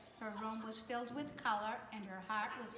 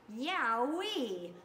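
big bright cheer of delight; joyful whoop; 2 semitones higher, 8 dB louder than usual. A narrator's reading. Mira cheering as she splashes the wall, loud joyful whoop. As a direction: let out a loud happy yell.

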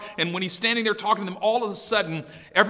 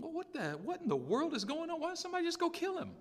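high, soft incredulity; hushed high-pitched bafflement, quickening at the end; baffled David; 8 semitones higher, 12 dB quieter, faster than usual. Acted. David voiced, baffled by giant.

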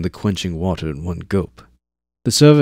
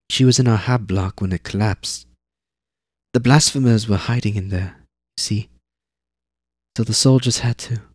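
put-on higher, factual kid; girl's voice; 4 semitones higher, 3 dB louder than usual. Zane explains the collapse to Eivor, high girl's voice. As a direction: explain it like you were told. Girl explains mother's death.

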